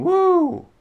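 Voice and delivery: high whooping cheer, loud; a sudden high party whoop; mock cheer. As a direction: whoop it up like a fan. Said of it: "It's just someone saying".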